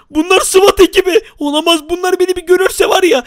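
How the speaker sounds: high-pitched mock panic; play-acted alarm. Gamer spots the SWAT team, high mock panic. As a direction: react in exaggerated high alarm.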